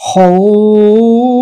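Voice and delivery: হউফ with the vowel held very long. Shown as a demonstration. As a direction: stretch হউফ over three alifs. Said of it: "তাহলে কি বলবো".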